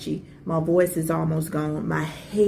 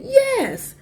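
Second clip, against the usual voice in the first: very high, strained yes; a silent plea, pitched high; desperate inner yes; 4 dB louder than usual. Retold or imitated. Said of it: "in my mind, I'm saying".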